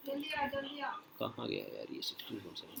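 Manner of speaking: very soft, slow, low mumble; muttering to oneself. Real, not acted. Teacher hunting for a section on the page, muttering to himself. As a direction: mutter under your breath while searching.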